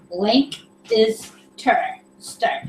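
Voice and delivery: slow, separated sounds of "Lank is stir"; syllable by syllable. Shown as a demonstration. Reading Tim's pronunciation guide aloud, slowly sounded out. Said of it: "but say it fast".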